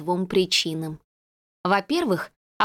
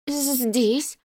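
trembling voice; 4 semitones higher than usual. A narrator's reading. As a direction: voice trembling with fear. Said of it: "ответила Людочка дрожащим голосом".

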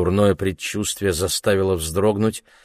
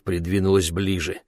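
usual speaking voice

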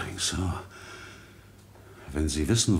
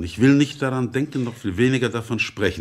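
louder, higher, shutting the topic down; agitated refusal; 5 semitones higher, 5 dB louder than usual. Acted. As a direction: push him away with your voice. Secretary refuses to discuss marriage.

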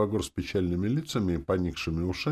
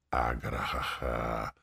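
slow, low demonic guffaw; hellish horse-laugh; 6 semitones lower, 5 dB quieter, much slower than usual. A narrator's reading. Demoness mocking the hero's wish, hellish horse-laugh. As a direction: neigh out a drawn-out evil laugh, well down in pitch. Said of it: "заржала она адской коньякой".

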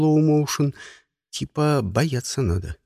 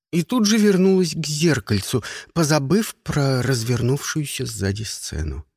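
usual speaking voice

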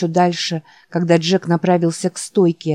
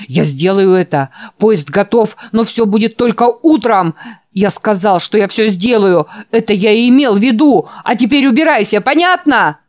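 loud, high panicked rant; hysterical old man; 4 semitones higher, 5 dB louder than usual. Acted. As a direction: rant at him in terror. Old man's frantic rant. (истерический крик старика)

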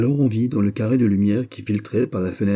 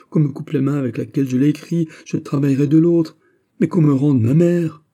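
higher and louder, swearing desperately; anguished pleading; 6 semitones higher, 4 dB louder than usual. A narrator's reading. Prisoner swears to the governor he meant nothing, anguished. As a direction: plead for your mother, voice straining.

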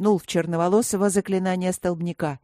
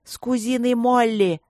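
high, tense confession; embarrassed and cross; grudging, heated admission; 5 semitones higher than usual. A narrator's reading. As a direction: force it out through gritted teeth. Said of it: "сквозь зубы призналась Эбби".

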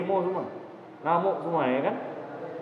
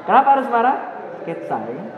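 normal talking voice

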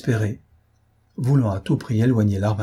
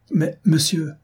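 high-pitched urgent protest; alarmed; 7 semitones higher, slower than usual. A narrator's reading. Gunner desperate to draw the shipowner away, alarmed. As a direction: break in, pitch raised in alarm. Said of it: "s'écria le maître canonnier véritablement alarmé".

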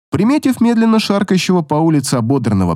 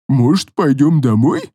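a monster playing coy; creepy fairy voice. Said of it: "Тем же голосом продолжила фея".

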